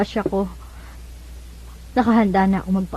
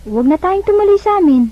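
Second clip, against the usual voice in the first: urgent plea; 9 semitones higher, 4 dB louder than usual. Acted. Ivy refuses to go home.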